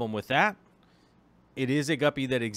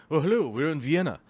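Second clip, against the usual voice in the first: put-on playful, vaguely Viennese accent, a bit louder; mock Viennese accent; 4 dB louder than usual. Guppy keeper recalling the championship trip, mock Viennese accent. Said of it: "about me making accents".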